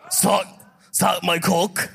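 lightly attempted Austrian-accented action-star English; Arnold. Host tries Arnold-style dirty talk for laughs, Austrian accent. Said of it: "So wie Arni redet, oder?".

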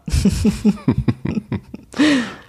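falling laugh, trailing off; amused laugh. Real, not acted. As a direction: laugh it out after the tease. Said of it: "laugh after teasing".

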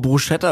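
"Bruschetta" with a German sh sound; wrong pronunciation. Shown as a demonstration. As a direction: say "Bruschetta" as broo-SHET-ta. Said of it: "so eine Sache mit der Aussprache". ("Bruschetta" falsch mit sch-Laut)

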